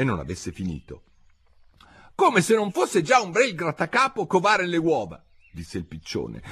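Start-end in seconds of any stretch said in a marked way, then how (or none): 2.1-5.2 high-pitched griping; high bird voice, full of grievance; aggrieved pigeon. A narrator's reading. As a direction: complain high, as a put-upon bird.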